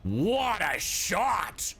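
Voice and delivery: ordinary talk, nothing marked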